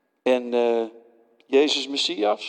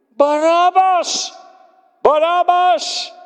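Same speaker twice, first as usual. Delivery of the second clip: slow, high, loud chant of the name; crowd shouting; 14 semitones higher, 6 dB louder, slower than usual. Acted. The mob shouts for Barabbas, slow and loud. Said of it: "riepen ze toen".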